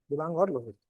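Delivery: ordinary talk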